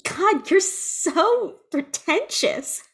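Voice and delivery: scornful retort. Acted. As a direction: stretch out the scorn. Acted retort to condescending witch.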